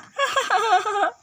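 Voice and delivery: high falling laugh. Acted. Bride laughing at her mother's scolding. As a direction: let out a giggle.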